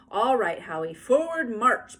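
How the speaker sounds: loud big-sister command; loud bossy order. A narrator's reading. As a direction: call the march like a leader. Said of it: "Beezus ordered".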